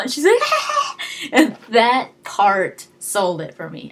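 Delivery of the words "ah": very high, loud fake scream; hammed-up squeal of fake fear; mock shriek. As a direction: shriek like a pretend-panicked civilian. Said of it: "freaking out".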